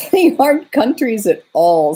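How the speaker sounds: put-on half-laughing, amused girl; almost laughing. A narrator's reading. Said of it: "almost laughing".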